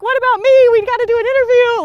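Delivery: high, loud mock complaint; whiny protest. Hunter protesting she wants her interview, mock whiny. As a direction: whine for your moment. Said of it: "I'm like".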